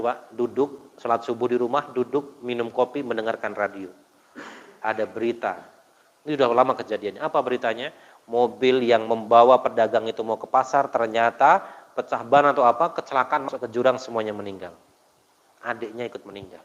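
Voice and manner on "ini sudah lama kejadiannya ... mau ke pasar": ordinary talk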